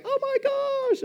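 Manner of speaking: a very high, slow, gasping oh my gosh; mock crowd gasp. Fans watching the Hail Mary, very high gasp. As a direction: gasp as the ball flies.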